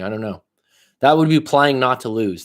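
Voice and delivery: normal talking voice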